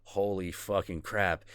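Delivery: usual speaking voice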